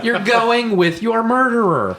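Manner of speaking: loud, high, stretched-out exclamation; incredulous outcry. Real, not acted. Host scolding the Doctor about his murderer, incredulous outcry. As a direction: draw it out in amused protest. Loudly objecting to the absurd plot.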